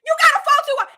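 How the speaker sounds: high, loud, scornful retort; mock yelled comeback. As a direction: snap it back at her like a fed-up fan. Mocking character's snappy reply.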